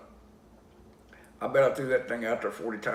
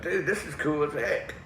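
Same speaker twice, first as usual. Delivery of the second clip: slow, higher, impressed buddy; Dana; 5 semitones higher than usual. Retold or imitated. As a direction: draw it out like an excited friend. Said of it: "He was like".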